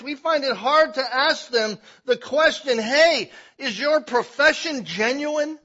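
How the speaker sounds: loud, high, driving sermon climax; full-force preaching. Acted. Preacher pressing the church, loud and rising.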